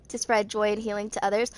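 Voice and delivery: usual speaking voice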